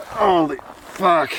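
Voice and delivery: slow, high, drawn-out holy fuck; awed exclamation. Real, not acted. Hiker back on the official trail, slow awed exclamation. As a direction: let the relief stretch out. Relief at finally rejoining the trail.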